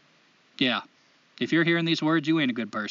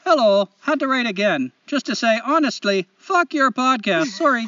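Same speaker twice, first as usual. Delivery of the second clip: higher, exaggerated Canadian lilt; Canadian accent; 5 semitones higher, 4 dB louder than usual. Host reading a listener's letter aloud, hammed-up Canadian accent. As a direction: put on a chipper, apologetic Canadian accent. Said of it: "in the best Canadian accent I know".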